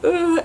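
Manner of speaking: high mock groan of dread; a sudden high, loud ugh; husband's uneasy groan, mocked. Damon's groan at the start.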